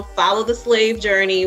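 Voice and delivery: ordinary talk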